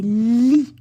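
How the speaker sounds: "li" with a long held L, loud; syllable demonstration. Shown as a demonstration. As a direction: stretch the L of "li" out loudly. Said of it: "La deuxième syllabe".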